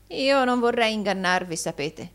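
high opening, falling away; mildly whining protest; moaning. A narrator's reading. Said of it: "gemé Arturo Gride".

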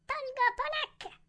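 slow, very high squeaky order; high-pitched pipsqueak commander; tiny falsetto command. A narrator's reading. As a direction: give orders as a thumb-sized creature. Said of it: "sentii uno di loro comandare".

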